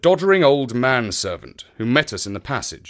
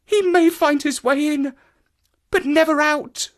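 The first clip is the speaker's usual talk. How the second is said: very high, exultant female cry; fierce merriment. A narrator's reading. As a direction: gloat over his doom. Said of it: "with fierce merriment".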